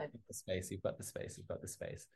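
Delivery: very soft, low whisper; hushed encouragement. Real, not acted. Host calming a nervous contestant, hushed encouragement. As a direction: murmur it to settle nerves.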